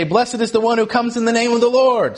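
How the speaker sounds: lifted voice, acted crowd call. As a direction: proclaim it like a crowd over the walls. People call from inside walls.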